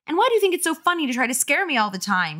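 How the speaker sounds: high, quick indignant questioning; angry demand. A narrator's reading. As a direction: accuse a pesky brother rapidly. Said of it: "Carly Beth demanded angrily".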